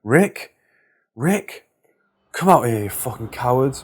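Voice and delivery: mock screaming. A narrator's reading. Drunk man yells past her into the apartment, mock screaming. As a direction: call out for someone with a restrained yell. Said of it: "started screaming".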